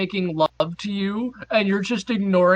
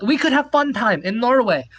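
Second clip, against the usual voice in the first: higher, louder put-on Norwegian accent; the Norwegian woman pushing her offer; mock Norwegian accent; 5 semitones higher, 3 dB louder than usual. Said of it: "this accent is".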